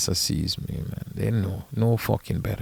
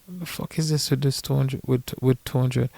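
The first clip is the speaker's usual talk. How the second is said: retold disbelief; 6 semitones higher than usual. Caddy challenging a two-hundred payment, high mock disbelief. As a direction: act out a baffled objection in a raised pitch. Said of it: "we're texting you like".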